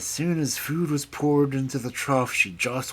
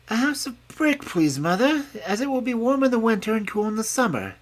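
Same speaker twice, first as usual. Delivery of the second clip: high, eager piglet voice; sensible little pig; 12 semitones higher, 5 dB louder than usual. A narrator's reading. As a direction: reason it out brightly. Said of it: "That is a sensible little pig".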